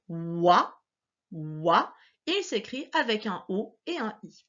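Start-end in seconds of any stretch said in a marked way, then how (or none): 0.1-1.9 slow, stretched WA on its own; low, drawn-out WA for a beginning reader; isolated sound. Shown as a demonstration. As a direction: glide slowly from OU into A for WA. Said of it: "le son wa".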